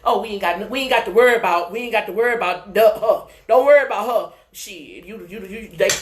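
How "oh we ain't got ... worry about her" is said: loud, quick, scoffing voices; dismissive doubters. Acted. Doubters writing off the chosen one, loud and dismissive. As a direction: wave her off loudly and fast. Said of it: "there were people who were downcasting you".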